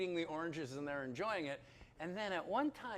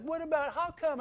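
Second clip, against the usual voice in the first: anxious questioning; 10 semitones higher, 7 dB louder than usual. Retold or imitated. His own anxious questions.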